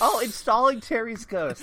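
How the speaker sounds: slow, high and loud mock announcement; mock install announcement. Friends joking about a dead author, slow high mock announcement. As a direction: announce it like a loading screen, hammed up.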